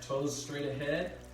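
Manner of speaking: ordinary delivery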